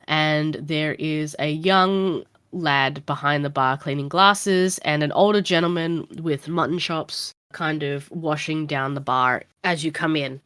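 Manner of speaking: ordinary delivery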